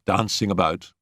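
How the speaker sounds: plain reading voice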